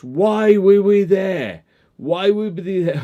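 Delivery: mock outraged police question. Police asking why they were there.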